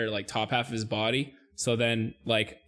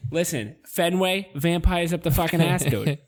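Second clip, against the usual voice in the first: higher Boston tough-guy voice; Boston accent; 4 semitones higher than usual. Pretend. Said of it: "Boston accent that we can do".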